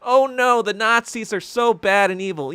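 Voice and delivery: high, sing-song mock outrage; mocking whine. Streamer parodying Western moralizing with high mock outrage. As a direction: parody their pious complaint. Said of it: "it all starts with".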